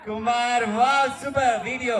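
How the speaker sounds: high, loud delighted cheer; excited. Real, not acted. Host praising a guest's answer, excited cheer. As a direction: burst out with joy.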